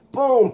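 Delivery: high punched-out "boom"; comic-book punch sound effect; impact boom. Acted.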